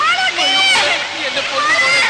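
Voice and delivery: wailing. Acted. Mother wailing for her daughter in the well. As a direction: cry out in grief for the child.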